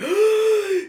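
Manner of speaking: sharp high gasp of delight; excited gasp. Retold or imitated. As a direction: gasp like you just found your soulmate. Gasp at soulmate cop.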